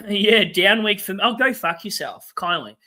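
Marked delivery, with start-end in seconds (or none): none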